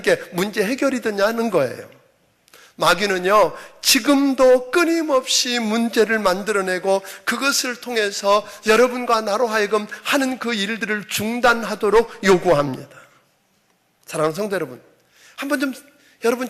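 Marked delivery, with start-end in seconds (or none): none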